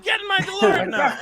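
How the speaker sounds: hammed-up Qui-Gon shouting to hurry; mock urgent order. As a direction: bark it like a panicked time traveller. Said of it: "He goes".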